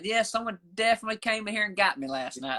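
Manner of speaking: just report the uncle's words; quoting uncle. Retold or imitated. Retelling the uncle's morning phone call, lightly voiced. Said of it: "That's what he said".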